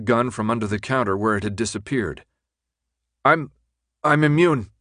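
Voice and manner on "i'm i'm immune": halting, raised and nervous protest; stammered, hesitant insistence; stammering. Acted. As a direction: break off, then restart higher. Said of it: "he stammered".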